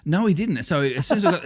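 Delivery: ordinary talk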